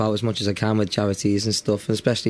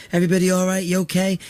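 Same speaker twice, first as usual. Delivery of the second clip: raised, high stage call to the audience; calling out to crowd; 9 semitones higher, faster than usual. Retold or imitated. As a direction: greet the whole room from the stage. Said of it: "I say".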